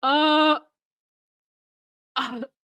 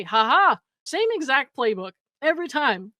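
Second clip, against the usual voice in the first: ordinary talk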